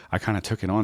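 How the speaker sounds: ordinary talk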